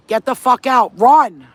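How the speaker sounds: loud, forceful warning shout; urgent scream. Acted. Warning viewers to leave a user, urgent yelling. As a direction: shout it like an order to flee. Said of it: "I'm gonna come and scream into your ear".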